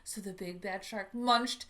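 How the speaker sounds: put-on lively storybook reading for children; picture book read-aloud. A narrator's reading. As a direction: read it to a room of kids.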